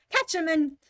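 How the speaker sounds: high, loud scream; screaming rabbit. A narrator's reading. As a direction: scream the order. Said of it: "the rabbit's voice screamed".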